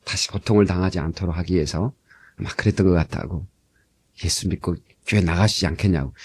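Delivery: quiet tender invitation to church; gentle counsel. Retold or imitated. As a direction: coax him gently toward faith. Pastor gently counsels ex-convict.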